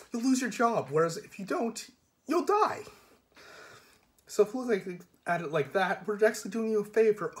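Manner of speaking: high, breezy and delighted; bright cheerful menace. Acted. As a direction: sell the threat as good news. Cheerful threat, pitch soaring.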